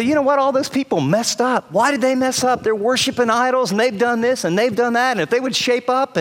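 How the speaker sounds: high-pitched, exasperated finger-pointing; acted blaming complaint. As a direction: complain about everyone else, pitched well up. Acts out an imagined blaming complaint.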